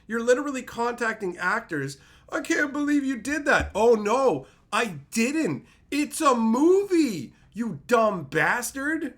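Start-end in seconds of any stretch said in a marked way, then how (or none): 2.3-3.7 mock fan. Mocking fan letter. 3.7-9.1 loud, slow mock fury at the fan; angry retort. Voicing actor's furious reply.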